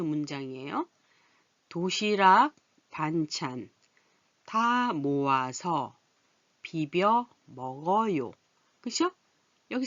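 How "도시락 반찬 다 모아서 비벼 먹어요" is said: slow, drawn-out reading for learners; slow reading aloud. Shown as a demonstration. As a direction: read each word slowly for the class. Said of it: "한번 볼까요". (천천히 또박또박 읽기)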